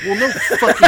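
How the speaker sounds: high, loud mock protest; exasperated yell breaking into laughter; indignant outburst. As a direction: protest loudly as wrongly accused Balaam. Balaam's indignant reply to angel.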